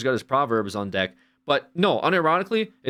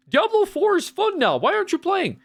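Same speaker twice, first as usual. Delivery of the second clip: much higher, louder singsong mockery of fans; mocking fan voice; 4 dB louder than usual. Mocking Diablo fans who come back to pester, high and singsong. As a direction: parrot the returning fans in a silly high voice. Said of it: "they'll say".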